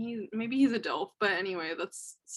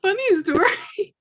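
high, loud, laughing mock excuse; sheepish Ram; 9 semitones higher, 6 dB louder, slower than usual. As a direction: fake innocence, cracking up. Ram retold dodging the question.

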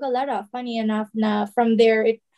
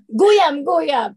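guyam said higher with Mindoro lilt; Mindoro word; 5 semitones higher, 6 dB louder than usual. Shown as a demonstration. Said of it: "Or ano pa?".

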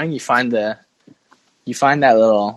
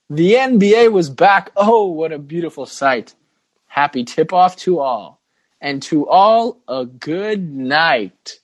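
raised, sing-song poem reading, slowing on the last line; Christmas poem recital; 4 semitones higher than usual. Acted. Host opening the podcast with a Christmas poem, recited. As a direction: recite it like a holiday bedtime verse. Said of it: "That was our Christmas poem".

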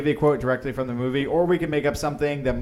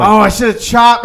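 loud, high, slow groaning yell; exasperated shout; 9 semitones higher, 13 dB louder than usual. Real, not acted. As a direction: shout at your own mistake. Realizing his mistake, shouting.

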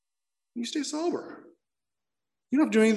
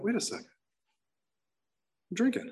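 sudden realization; 7 semitones higher, 6 dB quieter than usual. Retold or imitated. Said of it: "And I went".

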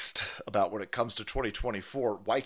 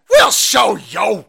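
loud, slow, drawn-out threat; villain yell; 8 semitones higher, 17 dB louder, much slower than usual. Retold or imitated. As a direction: bellow it like a cartoon villain. Mocking the elite's threats.

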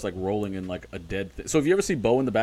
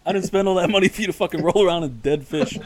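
mock-indignant snob, pitched up, laughing; pompous snob; 6 semitones higher than usual. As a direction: play the haughty owner scolding, half cracking up. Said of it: "trying to sound like a pompous asshole".

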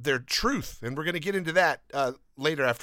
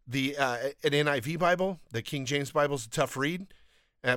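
ordinary talk, nothing marked

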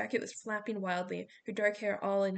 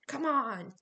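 urgent call; 4 semitones higher, 5 dB louder than usual. A narrator's reading. Coach urging kids in storm.

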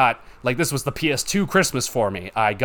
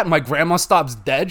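ordinary talk, nothing marked

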